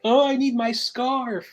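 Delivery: mock the kid whining for his scarf; mocking whiny kid.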